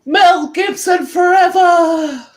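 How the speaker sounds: high, loud, drawn-out scream of "Mel Gibson forever!"; screaming punk. Acted. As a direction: yell "Mel Gibson forever!" slowly and loudly as he speeds past. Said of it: "He screams".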